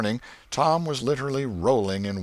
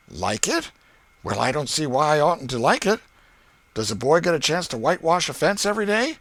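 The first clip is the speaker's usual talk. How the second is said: put-on lightly raised, louder boyish voice, quick at the end; boy Tom, sly; 7 semitones higher, 5 dB louder than usual. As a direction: play it cool and sly like a scheming kid.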